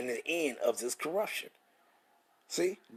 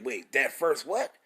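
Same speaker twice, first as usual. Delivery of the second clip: ordinary talk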